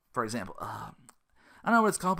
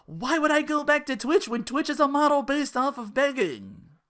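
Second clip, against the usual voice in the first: quoting the streamer; 8 semitones higher than usual. Retold or imitated. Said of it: "I can quote it".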